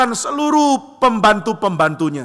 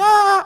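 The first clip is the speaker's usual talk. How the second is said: louder high newborn wail; baby cry; 4 dB louder than usual. Acted. The baby cries again on the sheep farm, high wail. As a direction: squeal out a baby's cry. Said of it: "Anaknya nangis lagi".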